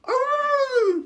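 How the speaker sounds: very high, loud wailing squeal; imitated TikTok noise. Imitating a TikTok noise from Regular Show, high wail. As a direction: let out a piercing cartoonish wail. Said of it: "it's a TikTok noise".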